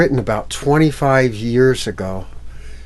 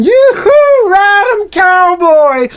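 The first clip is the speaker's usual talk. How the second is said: much higher, loud yodelling whoop; cowboy whoop; 10 dB louder, slower than usual. Acted. Silly whooping as the sofa gallops. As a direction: whoop it like a rodeo rider.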